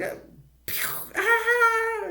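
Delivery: very high mock scream with gunshot noise; imitated yelling. Someone yelling at gunshots, mock high scream. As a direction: mimic a panicked yell and a shot. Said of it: "someone yelling".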